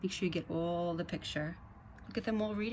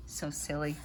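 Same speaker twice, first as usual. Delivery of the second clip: ordinary delivery, nothing marked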